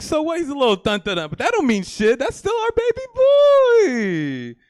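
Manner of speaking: retold exclamation. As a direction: build up to a big cry of support. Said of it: "I was like".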